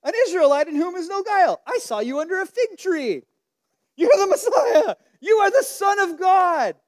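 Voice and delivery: rising to a high, loud, laughing shout; excited reenactment. Retold or imitated. Preacher acting out Nathanael's awe, building to a laughing shout. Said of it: "it's like".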